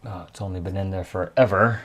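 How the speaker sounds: ordinary delivery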